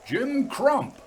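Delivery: high call across the dark; shouting. A narrator's reading. Harry calling up to the signalman's hut, shouting. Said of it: "he shouted".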